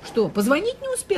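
pitched high, mocking disbelief; wife mocking her husband's lame excuse, sarcastic; sarcastic retort. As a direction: throw his excuse back at him, scoffing.

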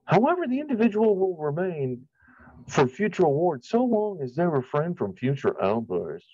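sniffy mock bureaucrat reading the notice; mock official reading. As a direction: parody the committee's pompous wording. Reading the committee's notice mockingly.

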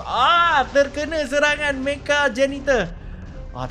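ordinary talk, nothing marked

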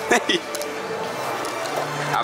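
ordinary talk, nothing marked

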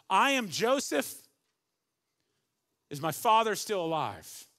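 loud, high, overflowing I am Joseph; bursting out. Acted. Joseph revealing himself to his brothers, bursting out loud. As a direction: let it burst from him. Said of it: "the first two things that come out of his mouth".

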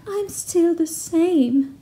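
high, slow, lightly voiced sad banshee. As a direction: drag out "I'm still the same" high and slow, like a lonely spirit. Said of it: "she said".

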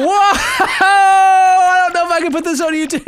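loud, slow, high-pitched laughing; helpless loud laugh-talk; laughing shout. Real, not acted. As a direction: shout it through real laughter. Cracking up at the game.

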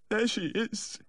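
high, weepy voice; plaintive high whimper; tearful. A narrator's reading. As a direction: say it high and mournful, wiping away tears. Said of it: "he said, wiping his eyes".

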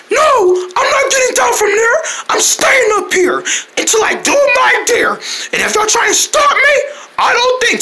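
high-pitched defiant holler; strained-up, stubborn yell; odd-sounding defiance. Acted. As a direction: shout back stubbornly in a high voice. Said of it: "Why you sound like that?".

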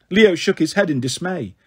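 plain reading voice

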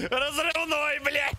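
high, laughing exclamations. Real, not acted. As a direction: crack up between the words. Laughs at a joke.

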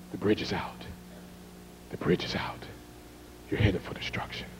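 hushed whisper. Retold or imitated. Man telling the driver the bridge is out, whispering. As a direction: whisper the warning urgently.

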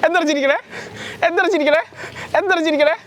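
high, teasing sing-song question; playful teasing. Real, not acted. Pappanji maker teasing a laughing child, playfully high.

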